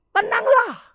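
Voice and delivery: loud, high, forceful call; commanding shout. Retold or imitated. Jesus tells frightened disciples to be calm, shouted. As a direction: shout it as a command over the storm.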